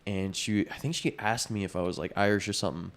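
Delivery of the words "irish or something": ordinary talk, nothing marked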